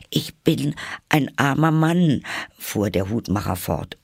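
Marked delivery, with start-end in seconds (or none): 0.1-2.2 high, halting timid voice; anxious high-voiced defendant; nervous plea. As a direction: plead pitifully, pitched up. Frightened Hatter pleads again.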